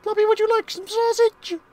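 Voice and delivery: high imitation of the sausage song; sausage song impression. Player imitates the sausage song. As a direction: squeak it high and looping. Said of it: "the fucking sausage song".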